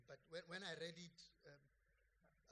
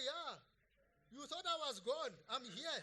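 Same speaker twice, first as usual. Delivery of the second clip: mock gloating; 7 semitones higher, 11 dB louder than usual. Voicing Jesus mockingly, then laughing.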